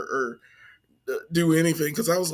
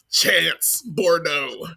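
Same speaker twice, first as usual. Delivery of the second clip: drawn-out name; much slower than usual. Acted. Savoring the Cajun name.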